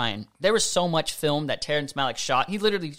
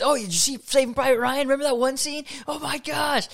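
excited schoolkids; 9 semitones higher than usual. Retold or imitated. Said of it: "all the kids, all the dudes".

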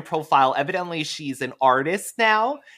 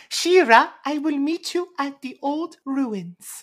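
high, slow mock French accent; a breathy-free lilting Mermista voice, unhurried; 10 semitones higher than usual. Mermista telling She-Ra where to meet, high French accent. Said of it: "she's like".

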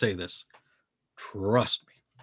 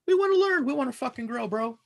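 high-pitched plea; 12 semitones higher than usual. Real, not acted. Pleading to haters.

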